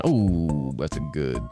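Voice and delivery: usual speaking voice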